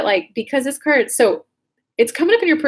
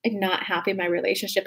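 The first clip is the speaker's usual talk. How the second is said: quiet realization; 3 semitones lower, 8 dB quieter than usual. Retold or imitated. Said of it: "it dawned on me".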